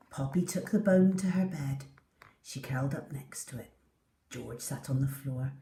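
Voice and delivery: usual speaking voice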